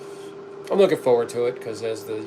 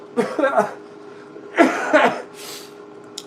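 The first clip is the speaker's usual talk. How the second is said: high, wheezy real cough; coughing fit; 12 semitones higher than usual. Real, not acted. Livestreamer interrupted mid-chat by a real coughing fit. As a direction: cough hard in repeated bursts. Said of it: "I'm coughing again".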